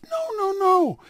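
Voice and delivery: sharply high, urgent no; insistent denial. Acted. Rabbi denies to the officer, high and urgent. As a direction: wave off the accusation in a high voice.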